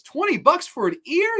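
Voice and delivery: high-pitched, affronted imitation of a haggler; mock incredulous. Orderly scoffs at a lowball morgue offer, incredulous. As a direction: jump up high in mock disbelief.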